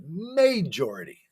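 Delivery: MAJORITY stressed on the first syllable, MAY-jority; MAJORITY said as an emphatic MAY-jority. Retold or imitated. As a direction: hit the MAY at the front of MAJORITY. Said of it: "I was trying to be emphatic".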